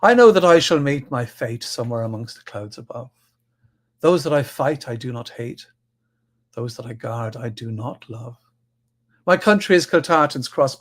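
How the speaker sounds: put-on poem read in a light Irish accent; an earnest, slightly rough Irish accent. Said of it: "excuse my bad Irish accent".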